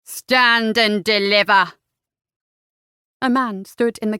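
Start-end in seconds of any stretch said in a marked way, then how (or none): 0.0-1.7 slow, loud highwayman's demand; a menacing robber halting a coach. A narrator's reading. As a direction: stop them in their tracks, drawn out. Highwayman halts the carriage.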